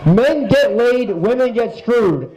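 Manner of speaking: slow, spaced-out announcement; category announcement. Real, not acted. Host announcing a joke category to the crowd, slow and deliberate. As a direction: announce it slowly, word by word.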